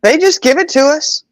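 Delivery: put-on a high kid protesting to Mom; kid's voice. Sons explaining free food to Mom, high whiny kid voice. Mother voicing her sons' reply.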